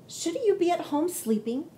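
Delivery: a curious opossum asking; high opossum voice. Acted. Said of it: "he asked".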